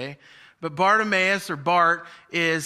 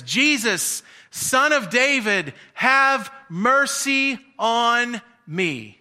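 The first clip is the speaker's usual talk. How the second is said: higher and louder, stretched-out cry; loud shout; 6 semitones higher, 6 dB louder, much slower than usual. Retold or imitated. Blind man shouting louder to Jesus over the crowd, stretched cry. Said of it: "he shouts out even louder".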